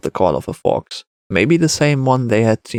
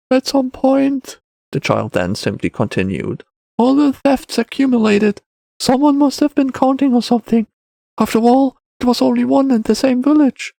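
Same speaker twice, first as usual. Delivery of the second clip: put-on high-pitched matter-of-fact boy; child character. A narrator's reading. Boy explains to the Wanderer, high child voice. Child figure in narrated story.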